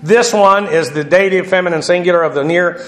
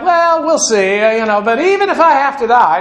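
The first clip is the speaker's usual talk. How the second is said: much higher, hammed-up noncommittal voice; Peter hedging; 6 semitones higher than usual. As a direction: hem and haw in a high mock voice. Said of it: "Peter's hedging his bets".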